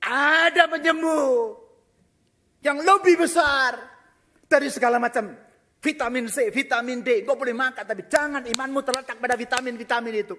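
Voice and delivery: loud, rising sermon shout; fiery preaching. Acted. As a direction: preach it at full force to the congregation. Preaching at full force.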